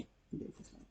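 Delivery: breathy whispered mutter; whispered aside. Real, not acted. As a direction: whisper it under your breath. Whispered repeat to herself.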